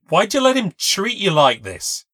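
loud, high angry demand; lost it. A narrator's reading. Narrator losing her temper with Kayla, angry and loud. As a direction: blow up at her friend. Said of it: "I just lost it".

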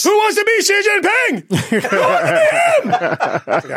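high laughing yell; laughing shout. Real, not acted.

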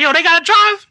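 high, loud questioning voice; coworker asking. Retold or imitated. Coworker checking if they drive, high and loud. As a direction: ask it up high, a bit loud. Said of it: "And then he's like".

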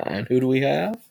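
usual speaking voice

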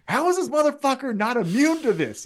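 high-pitched exasperated disbelief; Swerve. Acted. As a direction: wail it in baffled mock frustration. Acting Swerve baffled by rival.

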